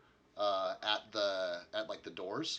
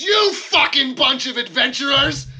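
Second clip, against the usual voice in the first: enraged high yell; livid dwarf; 19 dB louder than usual. Acted. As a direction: scream abuse at the adventurers at full blast. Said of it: "he's just fucking, like, livid".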